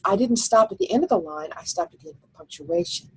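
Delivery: usual speaking voice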